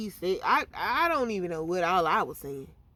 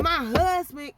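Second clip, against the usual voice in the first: higher, louder disbelieving cry; shocked outburst; 6 semitones higher, 8 dB louder than usual. Retold or imitated. Hearing her husband made a report, shocked and loud. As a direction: blurt it out stunned.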